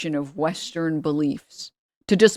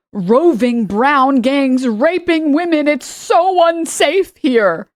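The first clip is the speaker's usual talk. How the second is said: high, loud, drawn-out panic; exaggerated high-pitched fearmongering, each word stretched out; hysterical mock alarm; 7 semitones higher, 5 dB louder, much slower than usual. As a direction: wail it like an overblown alarmist. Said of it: "Where Tucker Carlson was like".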